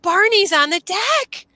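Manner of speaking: high, drawn-out announcement; retold exclamation. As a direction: act out the son's urgent news. Son's exclamation acted out.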